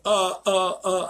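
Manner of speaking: mock a shaken man's stuttered noises; shaken stammer. Would-be trainer facing a snarling dog, mock shaken stammer. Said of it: "he's shook".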